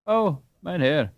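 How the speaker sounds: slow, high earnest cry; earnest plea. Acted. Hans protesting to Peter, earnestly. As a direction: plead sincerely with the young master. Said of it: "cried Hans earnestly".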